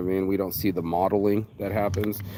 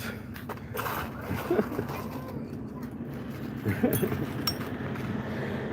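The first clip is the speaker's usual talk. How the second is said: airy laugh; 10 dB quieter than usual. Real, not acted. Laughing at people.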